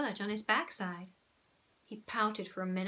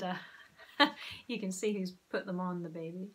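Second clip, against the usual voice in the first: usual speaking voice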